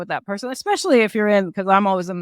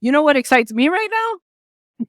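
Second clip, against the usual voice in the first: louder, higher, bubbling over with excitement; eager excited line; 7 semitones higher, 7 dB louder than usual. Shown as a demonstration. Networker turning the question back on herself, excited. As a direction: share it like you can't hold it in. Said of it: "you can even say".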